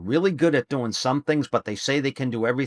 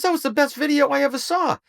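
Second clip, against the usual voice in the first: high-pitched gushing praise; mock amazed praise; imitating excited friend; 14 semitones higher than usual. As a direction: gush like an impressed fan. Retells Bob's praise.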